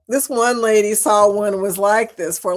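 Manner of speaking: ordinary talk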